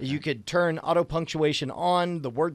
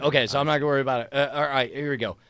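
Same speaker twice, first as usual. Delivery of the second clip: usual speaking voice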